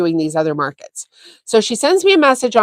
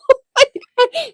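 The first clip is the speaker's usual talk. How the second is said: high burst of laughter. Real, not acted. Recalling a funny market moment.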